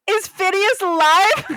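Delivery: high, loud, drawn-out question; mock hopeful excitement. Friend wrongly guesses the dead bird is back, mock excitement. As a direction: squeal the hopeful guess slowly.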